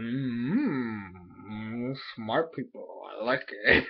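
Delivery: low, soft, drawn-out approving hum; scheming creature villain. Retold or imitated. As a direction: muse like a cartoon villain. Voices the villain Malamar's approval.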